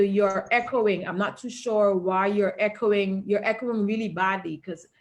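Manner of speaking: normal talking voice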